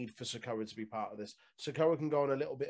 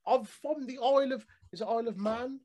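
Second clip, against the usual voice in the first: high, loud nasal wrestler impression; English Squidward voice; 12 semitones higher, 15 dB louder than usual. Co-host mocking Nathan Fraser's promo, high and nasal. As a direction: ham up a whiny young wrestler. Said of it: "his English Squidward voice".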